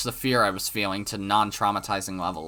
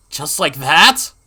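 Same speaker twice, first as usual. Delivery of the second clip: high, slow, loud frightened question; fearful question; 4 semitones higher, 8 dB louder, slower than usual. Acted. Narrator realizing she stood behind him, frightened. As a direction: let the dread rise on the last word.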